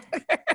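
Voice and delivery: high burst of laughter. Real, not acted. Laughing at own story.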